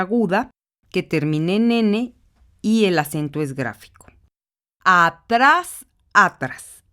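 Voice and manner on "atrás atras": teacher leaning on tras; stressing tras. Shown as a demonstration. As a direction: hit the last syllable of atrás. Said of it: "la sílaba tónica se encuentra en tras".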